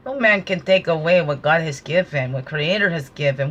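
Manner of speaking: ordinary delivery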